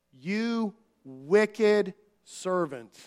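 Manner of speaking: very slow, weighty rebuke; grave slow pronouncement; slow solemn verdict. Retold or imitated. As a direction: let each word land slowly and heavily. Jesus' verdict on servant.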